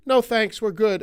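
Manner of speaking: high, loud, breezy refusal; curt brush-off. Retold or imitated. Homeowner turning the girl away at the door, a high, loud brush-off. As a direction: shut the door on a kid. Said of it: "they said".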